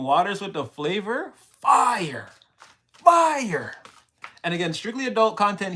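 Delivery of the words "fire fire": loud, high burst of hype; hyped exclamation. Real, not acted. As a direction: shout it like it's the best drink ever. Praising the sodas with hype.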